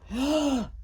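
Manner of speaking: soft storyteller's gasp; little intake of breath for effect; mock gasp. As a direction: gasp in pretend suspense. Dramatic gasp for young listeners.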